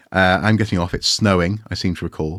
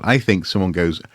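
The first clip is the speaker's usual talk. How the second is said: usual speaking voice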